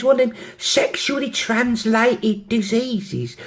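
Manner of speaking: put-on high, drawn-out London accent. London girl explaining her illness, London accent. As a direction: spell it out slowly in put-on London. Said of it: "have a go at the accents".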